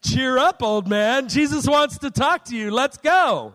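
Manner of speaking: hammed-up change of heart, urging him along; sudden cheery encouragement. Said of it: "we wanna be on your good side".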